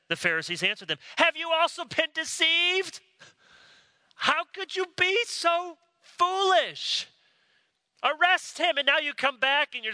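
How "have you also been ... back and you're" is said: high, incredulous scolding; mock the officers as fools; scornful rebuke. Pharisees rebuking the deceived officers, high and scornful.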